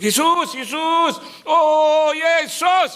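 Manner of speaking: long, high wailing calls; mock desperate crying out. Preacher imitating people screaming only once trouble comes, crying out. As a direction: stretch the name out in a high, loud cry. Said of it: "baru teriak-teriak".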